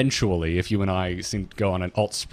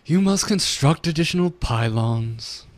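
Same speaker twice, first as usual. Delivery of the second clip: slow, higher-pitched haughty elf voice; snooty Blood Elf; 6 semitones higher, slower than usual. Acted. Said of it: "I want the snooty Blood Elf voice".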